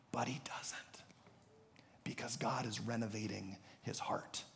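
sudden drop to a near whisper; soft, reverent hush. Real, not acted. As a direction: let it land very softly after the outburst. Hushed turn after the outburst.

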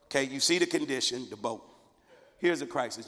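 ordinary talk, nothing marked

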